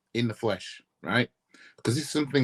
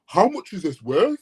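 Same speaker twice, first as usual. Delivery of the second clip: lightly raised, rising mock question; mock owner question; 6 semitones higher, 4 dB louder than usual. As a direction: play a silly owner pricing his Ferrari.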